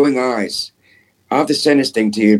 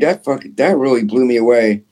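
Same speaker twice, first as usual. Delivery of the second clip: ordinary delivery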